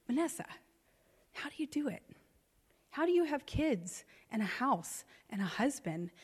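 a student's hushed, earnest question to her teacher; sincere quiet plea. Retold or imitated. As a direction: ask gently, almost in a whisper. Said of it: "she sincerely asks me".

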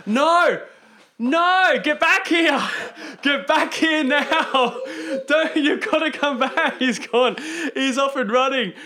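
loud, laughing call to come back; mock shout. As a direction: yell after him, half laughing. Friend admits never seeing film.

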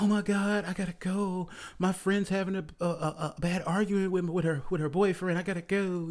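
high, breathless mock urgency; fake panicked date. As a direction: pretend the friend's emergency is real. Woman faking emergency on phone.

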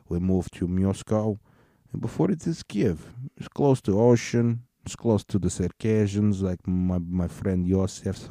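mock Russian accent, rambling. Russian explaining biological weapons to the listeners, Russian accent. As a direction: put on a thick Russian accent, slipping into broken Russian. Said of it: "in that accent".

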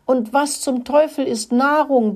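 high-pitched outburst of temper; angry bellow. A narrator's reading. Rat Rudi erupts at Mokel's explanation, a storybook roar. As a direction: explode in exasperation, pitched well up. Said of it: "brüllte Rudi los".

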